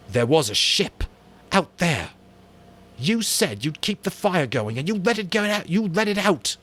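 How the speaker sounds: high, loud, trembling reproach; barely holding his fury in; shaking with anger. Acted. Ralph accuses Jack of letting the fire die, shaking. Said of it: "his voice shook".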